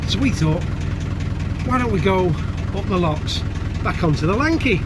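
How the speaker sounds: blustery outdoor recording; wind on mic. Real, not acted. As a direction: wind roaring over the mic. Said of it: "Sorry about the wind noise".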